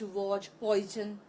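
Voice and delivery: वॉज, पॉइजन में ज ज़ोर देकर धीरे बोलो; शब्द धीरे खींचकर गलत ज के साथ; धीमा, खिंचा उच्चारण. Shown as a demonstration. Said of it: "वो ज बोल देते हैं". (गलत ज वाला उच्चारण धीरे-धीरे)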